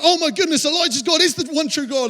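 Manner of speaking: high-pitched amazed outburst; freaking out. Acted. Crowd seeing fire from heaven, freaking out. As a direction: gush in a rush, pitched well up. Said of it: "Everyone starts freaking out".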